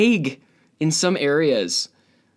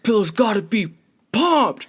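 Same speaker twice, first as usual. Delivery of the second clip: high, strained panicky voice; frantic mom; 8 semitones higher than usual. Retold or imitated. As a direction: squeal like a stressed mom. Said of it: "mom freaking out when guests are coming over".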